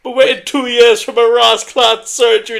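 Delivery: loud, high, hammed-up Jamaican accent; mock Jamaican accent. Joking on the podcast in a loud mock Jamaican accent. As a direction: shout it in a cartoon Jamaican voice.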